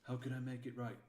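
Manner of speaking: ordinary delivery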